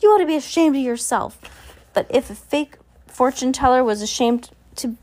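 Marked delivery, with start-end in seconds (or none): 0.0-1.4 high-pitched indignant scolding; shrieked scolding. A narrator's reading. Sunny's shriek translated for the fortune teller, scolding high. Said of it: "Sunny shrieked, which meant something along the lines of".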